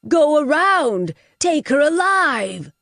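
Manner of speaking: slow, loud barked orders; bellowing commands. Acted. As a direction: drive his men after her. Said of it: "Hugh bellowed".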